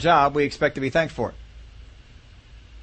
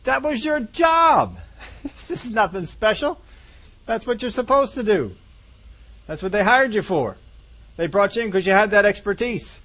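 much higher, scoffing brush-off; dismissive retort; 7 semitones higher than usual. Acted. Boss brushing off a worker's work, scoffing and much higher. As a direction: wave away their effort like it's nothing. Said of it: "we can sometimes get focused on".

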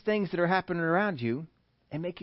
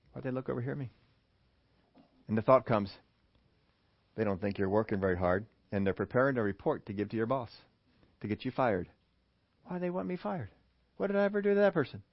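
suspicious inner voice; 6 semitones lower, 8 dB quieter, faster than usual. Acted.

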